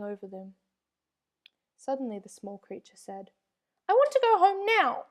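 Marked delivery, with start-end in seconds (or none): 3.9-5.1 very high, loud frightened wail; scared plea. A narrator's reading. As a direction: beg to go home in fear. Creature panicking on swamp.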